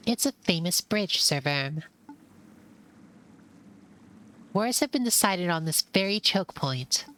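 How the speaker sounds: a keen mouse showing off his knowledge; eager know-it-all mouse. Acted. Same companion answers Sir Verm.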